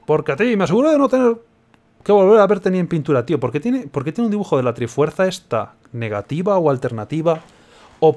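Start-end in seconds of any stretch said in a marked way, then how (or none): 0.0-3.3 higher, louder triumphant villain; mad scientist. Acted. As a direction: gloat like a crazed genius. Reading villain's lines.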